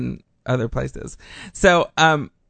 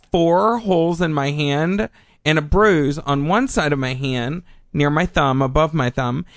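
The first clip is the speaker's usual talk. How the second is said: ordinary talk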